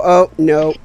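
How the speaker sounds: ordinary talk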